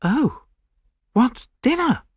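high-pitched, impatient retort. A narrator's reading. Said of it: "I said impatiently".

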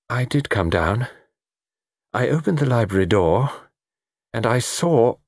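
lightly voiced faltering witness; confessing lady. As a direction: recount it reluctantly, breaking off.

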